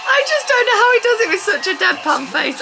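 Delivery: very high, laughing disbelief; laughing squeal. Real, not acted. Viewer amused by a deadpan face, laughing squeal. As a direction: squeal it through real laughter.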